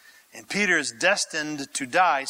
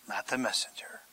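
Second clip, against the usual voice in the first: sudden hushed whisper; 7 semitones lower, 11 dB quieter than usual. Real, not acted. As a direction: drop to a secret whisper. Punchline dropped to whisper.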